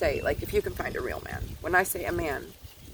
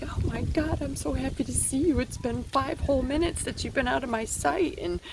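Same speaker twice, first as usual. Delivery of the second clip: high, gushing happy dog voice; giddy dog voice gushing at its owner coming home; excited dog; 9 semitones higher than usual. Retold or imitated. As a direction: play an overjoyed puppy greeting its owner. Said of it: "the dog's like".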